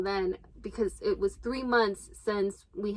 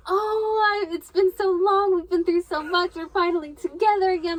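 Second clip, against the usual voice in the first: higher, louder, hammed-up swoon; mock romantic reunion; 7 semitones higher, 5 dB louder than usual. Wife reuniting with husband at the airport, hammed-up romantic swoon. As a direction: play the movie reunion moment. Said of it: "I'm ready for, like, my moment has arrived".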